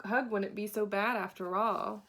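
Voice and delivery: ordinary delivery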